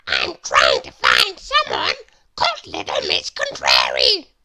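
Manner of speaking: very low, loud little man voice; Mister Small asking. Acted. Lost Mister Small asks for help, very low voice. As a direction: ask politely in a deep voice. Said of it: "said Mister Small".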